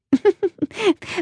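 a light high rabbit chuckle. A narrator's reading. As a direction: chuckle briefly to yourself. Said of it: "then chuckled".

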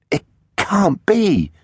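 high, drawn-out shock; stunned disbelief. A narrator's reading. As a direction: stretch it out, pitched high in disbelief. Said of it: "Teggs's jaw dropped".

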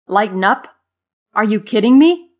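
loud, incredulous, climbing in pitch; exasperated. A narrator's reading. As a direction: throw the words back in disbelief. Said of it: "bulging with exasperation".

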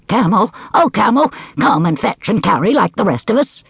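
high, eager dog; coaxing little dog. Acted. Dog speaking in story.

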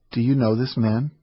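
usual speaking voice

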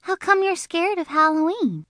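put-on high, curious girl voice; curious Shirley. Shirley asking Junie B, high and curious. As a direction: ask it high and nosy.

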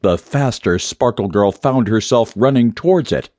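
ordinary delivery

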